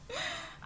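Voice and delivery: squealing high laugh; laughing at dad's grocery excuse, high squeal; high burst of laughter. Real, not acted. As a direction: break into a high-pitched laugh.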